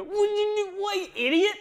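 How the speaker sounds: high, loud outraged scolding; exasperated yell. Retold or imitated. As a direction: yell at a teammate who blundered. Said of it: "doesn't look back and go".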